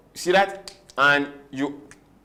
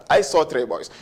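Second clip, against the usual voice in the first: ordinary delivery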